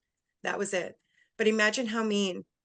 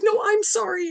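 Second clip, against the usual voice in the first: high, louder emotional insistence; distraught apology; 13 semitones higher, 5 dB louder than usual. Retold or imitated. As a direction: imitate an upset mother apologizing back. Mother apologizes back while holding her.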